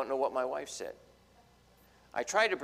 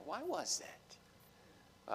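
whispered question; 5 semitones higher, 12 dB quieter, much slower than usual. Real, not acted. Rhetorical question to the congregation.

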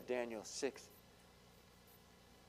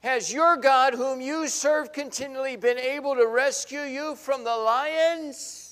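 much higher, loud pleading question; anguished shout; 10 semitones higher, 11 dB louder than usual. Acted. King desperately calling to Daniel, anguished shout. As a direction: shout the question down into the den, anxious. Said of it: "in an anguished voice".

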